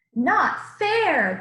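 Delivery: loud, high protest; crowd yelling. Acted. The crowd protesting at Jesus, a loud angry yell. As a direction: shout the complaint like an angry crowd. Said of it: "the crowd yelled".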